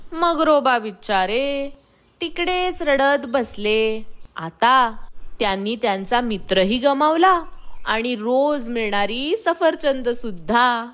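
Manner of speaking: ordinary delivery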